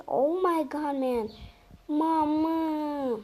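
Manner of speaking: usual speaking voice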